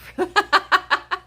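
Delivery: high burst of laughter; real laugh. Real, not acted. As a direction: laugh out loud in quick pulses. Laughs at own teasing.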